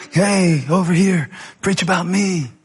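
playful mock yell for attention. Scripture phrase jumping off the page at the preacher, mock yell. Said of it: "and scream and yell".

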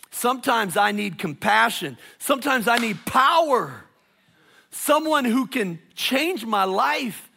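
building preach. Real, not acted. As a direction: build from strong to soaring.

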